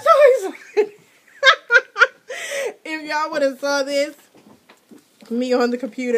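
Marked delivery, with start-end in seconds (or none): 0.0-1.0 high, loud squeal through laughter; laughing squeal. Real, not acted. Vlogger finishing the letter squeals and laughs with excitement.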